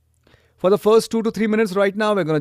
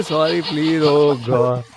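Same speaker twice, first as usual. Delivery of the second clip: ordinary delivery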